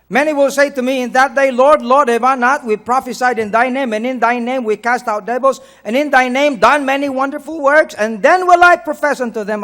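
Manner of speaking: loud, driving proclamation; forceful scripture reading. Acted. Preacher quoting those pleading on judgment day, loud urgent reading. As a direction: push the verse out at full preaching force.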